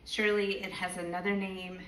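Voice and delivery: measured spoken-word poem, deliberate pauses; poetry reading. Acted. Poet reads to the event audience, measured poetry reading. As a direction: let each line land like a live poem.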